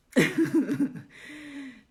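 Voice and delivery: loud burst of laughter; hearty laugh; big laugh. Real, not acted. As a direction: laugh out loud at your own joke. Laughs at her own joke.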